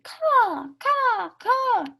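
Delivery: high, loud cawing like crows; crow caws. A narrator's reading. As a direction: caw up high like a startled crow. Crows fly away cawing.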